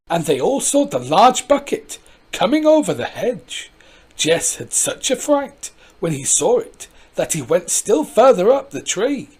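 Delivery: usual speaking voice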